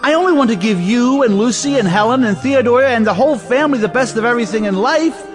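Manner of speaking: high-pitched indignant protest; wounded, worked-up complaint in a high voice; exasperated outburst. Acted. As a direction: plead your good intentions, aggrieved, pitched right up. Exasperated brother protesting in argument.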